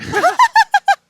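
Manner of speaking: sudden shrieking laugh, loud; real helpless laughter; high burst of laughter. Real, not acted. Laughing at the medicine's joke.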